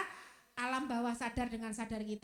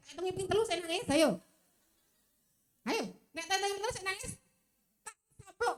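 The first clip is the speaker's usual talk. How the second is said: higher, quicker mock parental warning; hammed-up parent's warning, pitched up; threatening parent; 5 semitones higher, faster than usual. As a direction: play a parent counting down a threat. Acts parent threatening crying child.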